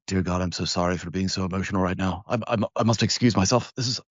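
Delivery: fast, lightly hammed-up British fluster; mock British. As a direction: play a flustered Brit apologizing in a rush. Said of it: "and they're like".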